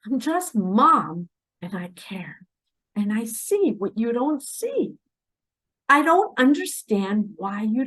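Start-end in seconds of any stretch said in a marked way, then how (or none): 1.6-2.5 whisper. Real, not acted. Mom confiding her care.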